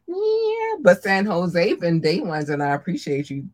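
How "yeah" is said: squeaky cute voice. Acted. Cutesy aside.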